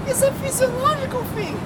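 put-on very high Mandarin-accented protest; imitated Mandarin accent. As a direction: mimic the coworker's defence in a high Mandarin accent. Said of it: "and she's".

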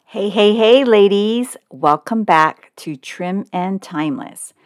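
ordinary talk, nothing marked

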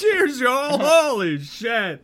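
real frustration at having to drink; loud laughing exasperation; exasperated outburst. Real, not acted. GM reacts to a drinking penalty.

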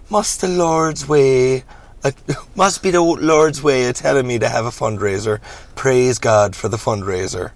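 drawn-out hammy reverend; theatrical churchman giving praise; preacher reverend, pious. Acted. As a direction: preach it slowly with mock piety.

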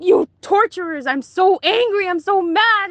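high, tearful accusation pitched well up; angry outburst. Retold or imitated. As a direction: lash out at them through tears. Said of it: "and I'm crying".

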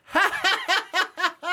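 very high, loud staccato mock laugh; imitated laugh. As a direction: imitate a TV character's forced high cackle. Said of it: "where he goes".

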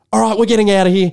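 fast, loud, high panicked exit line; scared bail-out. Retold or imitated. Harrison bolting from the birth, loud panicked rush. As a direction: rush it out like you're terrified. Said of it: "shit scared that he's like".

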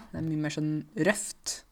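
ordinary talk

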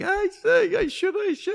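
high, loud wailing imitation of a crying kid; mock sobbing. Imitating a fighter bawling in the dressing room, mock sobbing. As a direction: wail the words through mock sobs.

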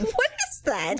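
high, sharp suspicious question; mock mom sniffing. Imitating mom sniffing out a smell, high and suspicious. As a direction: call out a strange smell. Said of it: "she'd be like".

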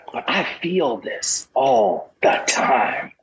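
slow, breathy half-crying whisper; tearful whisper. Real, not acted. Confessing to the host, a slow tearful whisper. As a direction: let it slip out on the edge of tears. Contrasts outward expression with inner feeling.

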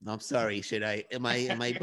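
ordinary talk, nothing marked

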